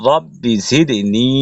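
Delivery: slow রব্বি জিদনি with a long final i; drawn-out madd. Shown as a demonstration. Teacher reciting after the madd rule, slow lengthened ending. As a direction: stretch the last vowel of জিদনি. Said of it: "এক লিপ টেনে পড়তে হবে".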